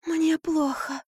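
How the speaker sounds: high, frail whimpering voice; feeble babble. A narrator's reading. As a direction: murmur weakly as if fainting. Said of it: "лепетала Марфа". (слабый высокий лепет)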